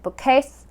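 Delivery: "Bookcase" with "case" stressed and rising in pitch; misplaced stress. Shown as a demonstration. Teacher repeating a hard to understand bookcase, wrong-syllable stress. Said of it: "hard to understand".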